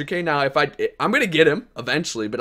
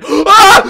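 very high loud laughing yelp; real delighted laugh-shout; excited laughing shout; 15 dB louder than usual. Real, not acted. Donation excitement.

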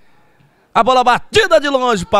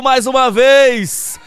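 normal talking voice

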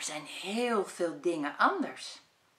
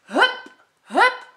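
high, loud cheerful call; rally him like a sports coach; 7 semitones higher, 8 dB louder, slower than usual. Acted. Job urges Ad to exercise, loud calling. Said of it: "roept Job".

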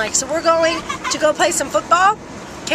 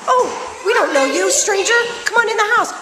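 mock host; 7 semitones higher than usual. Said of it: "And she's like".

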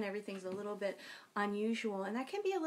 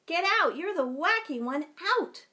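stern teacher; 8 semitones higher, 8 dB louder than usual. Acted. Teacher throws boy out.

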